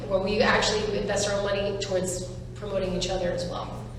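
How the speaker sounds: ordinary talk, nothing marked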